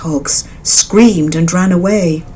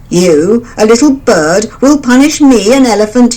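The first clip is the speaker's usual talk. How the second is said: mocking, louder and higher; mocking elephant; 4 semitones higher, 5 dB louder than usual. A narrator's reading. Elephant mocking the mother bird, louder. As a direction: scoff at the tiny bird's threat. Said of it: "The elephant laughed louder".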